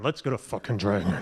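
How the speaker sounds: growly dragon demand. Pretend. Joking greedy demand.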